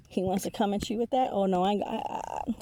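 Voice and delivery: ordinary talk, nothing marked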